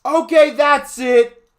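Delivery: very high, loud slow yell. Acted. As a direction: explode in frustration. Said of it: "Suni yelled".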